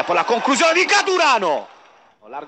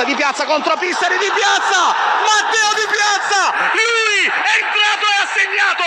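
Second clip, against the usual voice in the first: breathless italian goal commentary; 9 semitones higher than usual. Real, not acted. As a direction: roar the equaliser, stretching the name. Equaliser scored, goal call.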